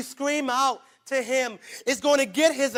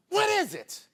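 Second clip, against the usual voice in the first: normal talking voice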